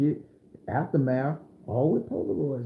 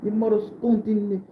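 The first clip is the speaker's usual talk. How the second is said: Jamaican man's threat; 6 semitones higher than usual. Retold or imitated. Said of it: "Brazilian looking Jamaican man".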